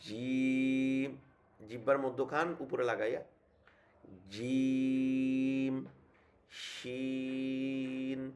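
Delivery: jim and shin held very long; sustained Arabic letter recitation; drawn-out letter names. Shown as a demonstration. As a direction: stretch জিম and শিন out slowly like a tajweed teacher. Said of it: "আমরা উচ্চারণ করি এরকম".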